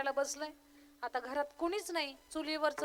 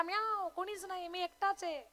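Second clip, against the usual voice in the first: high, soft meowing reply; taunting cat; 4 semitones higher, 5 dB quieter than usual. Acted. Tomcat answering helpless husband, high soft taunt. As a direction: answer with a sly little meow. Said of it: "बोका म्हटला".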